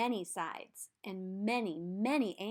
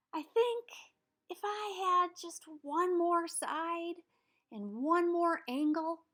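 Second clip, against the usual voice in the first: higher, slow little pentagon voice; 7 semitones higher, slower than usual. Acted. Pentagon asks the shapeshifter for another side, higher slow voice. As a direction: plead slowly in a higher small voice.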